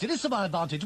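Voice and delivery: usual speaking voice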